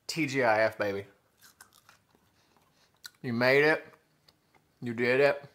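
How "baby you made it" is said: ordinary talk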